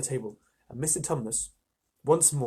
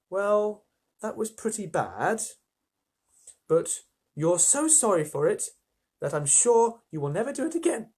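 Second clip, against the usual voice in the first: rather slowly; 7 semitones higher, 3 dB louder than usual. A narrator's reading. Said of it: "said Lucy rather slowly".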